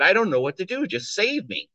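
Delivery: high-pitched plea without real volume; mild mock yell. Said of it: "I'm just yelling out to".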